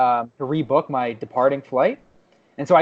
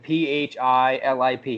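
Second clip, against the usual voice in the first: normal talking voice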